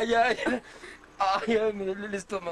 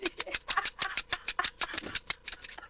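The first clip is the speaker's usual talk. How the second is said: hysterical laugh; 9 semitones higher, 5 dB quieter than usual. Real, not acted. Laughing fit, breathless.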